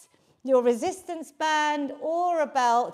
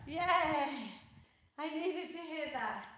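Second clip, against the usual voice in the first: panting after star jumps; breathless relief at the finish; out of breath; 10 dB quieter than usual. Real, not acted. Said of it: "I'm so out of breath there".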